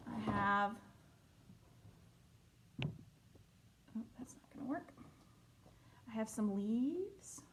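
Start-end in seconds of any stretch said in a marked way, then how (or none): 2.8-4.9 whispered aside. Real, not acted.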